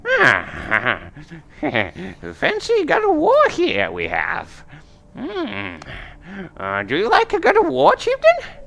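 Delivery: put-on very high-pitched old crone voice; old seer woman. Old seer reads bones for the chieftain, high crone voice. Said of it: "and she says".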